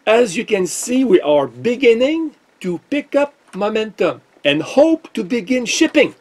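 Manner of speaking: sarcastic high sing-song reading; mock announcement voice. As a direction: parody the cheerful company line. Mocking the company email.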